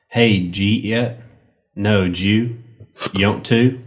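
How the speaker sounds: imitating a Southern accent with words run together; mock Southern accent, swallowing half of each word; Southern slurred speech. Host mimics Southern speech in a Foxworthy joke, Southern accent. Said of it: "We say things like".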